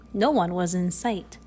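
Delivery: usual speaking voice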